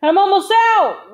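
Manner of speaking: urgent high, loud shout; yelling. A narrator's reading. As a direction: yell a warning to your squad. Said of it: "Ray yelled".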